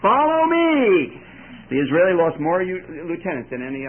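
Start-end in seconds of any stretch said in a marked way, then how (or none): 0.0-1.2 very loud, high, slow drawn-out shout; lieutenant's call. Retold or imitated. Lieutenant leading the charge, long loud call. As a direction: stretch it out and call the men on. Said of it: "he adds one more thing".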